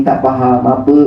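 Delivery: usual speaking voice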